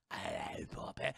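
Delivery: soft breathy sinister hello; creepy whisper. Retold or imitated. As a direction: whisper it from the dark. Pirate greets her creepily.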